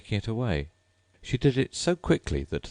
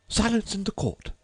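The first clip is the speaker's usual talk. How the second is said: much higher, squeaky rabbit cry for silence; rabbit calling out. A narrator's reading. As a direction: call the court to order as a fussy rabbit.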